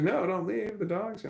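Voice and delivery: a hint of a child begging dad to stay; mild imitation of a daughter who doesn't want him to go; daughter pleading. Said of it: "He's like".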